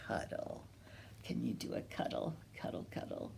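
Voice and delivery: soft whispered cuddle; hushed cozy cuddle whisper; tender whisper. Pretend. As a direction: whisper gently like hugging a pet.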